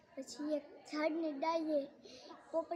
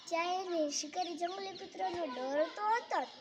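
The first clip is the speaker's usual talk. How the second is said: ordinary delivery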